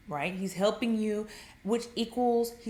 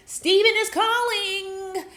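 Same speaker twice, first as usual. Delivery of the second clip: high, drawn-out gleeful announcement; hammed-up squeal by the phone; mock excitement; 10 semitones higher, 8 dB louder, much slower than usual. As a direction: sing out that he's calling. Acts out waiting woman's excitement.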